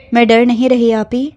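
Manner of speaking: usual speaking voice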